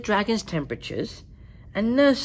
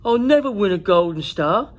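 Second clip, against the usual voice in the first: mock despair; 3 semitones higher, 7 dB louder than usual. A narrator's reading. Zog despairing.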